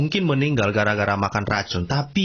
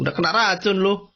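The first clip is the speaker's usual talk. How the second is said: mocking taunt; 6 semitones higher than usual. Mockers jeering at the poisoned prophet, mocking taunt. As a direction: crow it like a teasing bully. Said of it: "diejek-ejek o-, oleh orang Yahudi".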